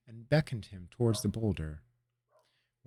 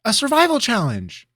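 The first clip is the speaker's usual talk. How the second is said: high, loud eager cry; excited interruption; 12 dB louder than usual. Acted. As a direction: jump in bursting with an idea. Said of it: "cut him off excitingly".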